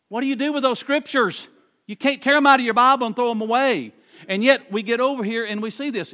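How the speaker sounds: fired-up preacher challenging the church; preaching. Acted. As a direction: drive the point home from the pulpit. Preacher rising to full force.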